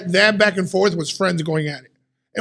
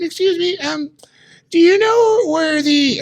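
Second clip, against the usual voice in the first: much higher, slow, small polite voice; timid shopper; 10 semitones higher, slower than usual. Retold or imitated. Lady at Aldi asks where something is, high timid voice. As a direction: play a shy lady asking for help, pitched up high.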